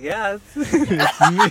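giggling shyly; laughing. Real, not acted. Retelling the cute guy asking her name, giggling. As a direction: laugh through the words. Laughing telling flirt story.

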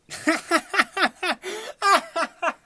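high burst of laughter. Real, not acted. Laughing at own tangent.

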